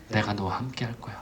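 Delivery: very soft, low, near-whispered promise; hushed reassurance. Acted. God's promise to Moses repeated, hushed and low. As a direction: drop almost to a whisper, reassuring.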